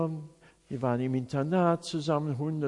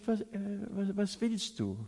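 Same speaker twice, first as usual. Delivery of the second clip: leve tartamudeo al preguntar, más suave; tartamudeo imitado; 4 semitones higher, 7 dB quieter than usual. Retold or imitated. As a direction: imita un ligero tropiezo al arrancar la pregunta.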